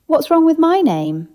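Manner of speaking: sharp, high and loud in offended protest; defensive. Acted. Entity Fred, offended at being doubted, defensive. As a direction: bristle at the slight, voice jumping up. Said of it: "the man said defensively".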